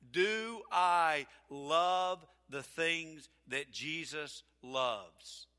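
slow it right down so they can write it; slow emphatic. Shown as a demonstration. Preacher dictates the key question for the congregation to write down, slow and weighty. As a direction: Do I love the things that Jesus loves drawn out slowly, each word weighed. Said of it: "Write this down".

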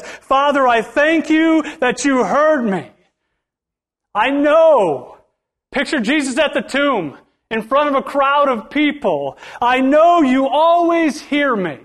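high, loud, slow heavenly prayer; preacher voicing Jesus at the tomb; hammed-up prayer. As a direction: pray aloud before the crowd.

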